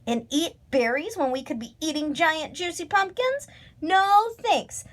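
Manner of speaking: high, cheeky retort; saucy. A narrator's reading. Crow refusing berries for pumpkins, high saucy comeback. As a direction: scoff at the very idea, high-pitched. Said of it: "said Lucy Crow saucily".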